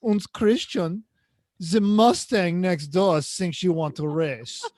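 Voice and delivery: put-on slow, hammy German accent; bad German accent, drawn out. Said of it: "I'm doing a bad German accent".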